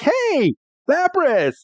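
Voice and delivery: very high, excited call; Pikachu calling. Retold or imitated. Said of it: "And Pikachu is like".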